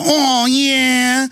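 mocking leering agents; mock TSA agents. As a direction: tease it out like a lecherous guard. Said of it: "We're going".

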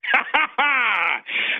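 short gloating villain laugh; evil villain laugh. Acted. Caller answers the host's villain remark, evil cackle. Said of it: "Sounds like an evil villain".

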